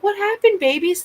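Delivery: high, loud alarmed coo to the cats; baby talk. Retold or imitated. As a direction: call out to your scared babies. Calling to frightened cats.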